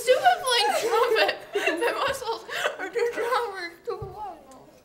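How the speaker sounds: laughing through a full mouth; giggling, barely getting words out. Real, not acted.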